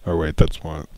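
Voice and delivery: ordinary delivery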